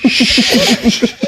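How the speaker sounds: long mimicked shush. Uncle silencing the chatty guy, shushing. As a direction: hush him with a drawn-out hiss.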